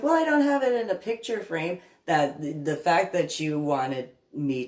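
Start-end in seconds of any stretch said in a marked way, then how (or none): 0.0-1.8 high defensive mock voice; mocking Pam. Imitating Pam excusing the photo, high defensive mock. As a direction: whine the excuse like a petulant child.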